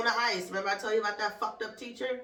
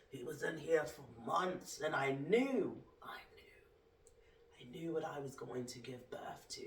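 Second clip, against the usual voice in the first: much softer, lower, fading to a whisper; hushed; 9 semitones lower, 12 dB quieter than usual. Acted. Telling how she knew her son before birth, hushed. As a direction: let it sink into a hush.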